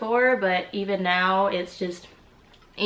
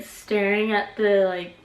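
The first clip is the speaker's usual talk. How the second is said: usual speaking voice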